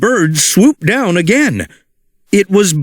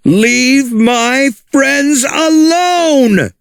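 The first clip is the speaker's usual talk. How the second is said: brave shout; 9 semitones higher, much slower than usual. Acted. Rex defending his friends, slow high brave shout. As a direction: yell it out to save friends. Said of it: "cried Rex".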